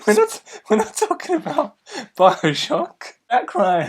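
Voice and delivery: high-pitched, helpless laughter through the words; laughing correction. Real, not acted. Co-host correcting a film-game name mix-up, laughing hard. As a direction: correct them while cracking up.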